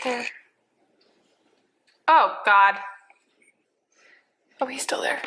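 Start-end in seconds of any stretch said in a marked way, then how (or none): 4.6-5.2 whisper. Real, not acted. Hushed so birds stay.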